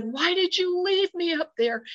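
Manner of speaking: high-pitched, pleading protest; upset reproach. Retold or imitated. Frightened witness to friends who fled, upset reproach. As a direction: reenact panicked reproach, pitched well up.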